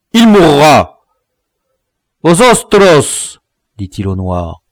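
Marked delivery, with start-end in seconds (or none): 0.1-3.4 high, loud angry decree; fierce insistence. A narrator's reading. Dwarf demanding the captive's death, fierce insistence. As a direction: pronounce the death sentence loudly.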